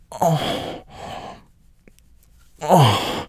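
high moan falling into a sigh; moaned exhale; breathy moan. Acted. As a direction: gasp out a short moan. Wordless reaction in intimate scene.

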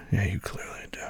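whispering. Acted. Whispered tease.

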